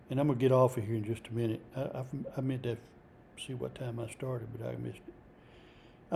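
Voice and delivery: low, soft aside, half to himself; quiet aside. Real, not acted. Preacher checking what time he started, quiet aside. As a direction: drop right down, as if checking privately.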